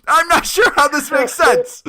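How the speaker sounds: high, loud comic exasperation; frantic bafflement. Retold or imitated. Musician explains singer's Nazi friendship to guitarist, frantically baffled. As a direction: explain it in a baffled near-shriek.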